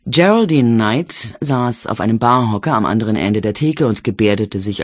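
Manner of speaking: ordinary delivery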